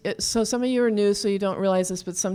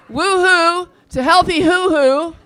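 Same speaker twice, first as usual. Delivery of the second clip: cheer; 7 semitones higher, 8 dB louder, slower than usual. Shown as a demonstration. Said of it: "we're saying".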